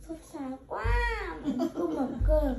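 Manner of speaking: half-hammed worried mother, loud; mum's loud fussing. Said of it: "xong mẹ".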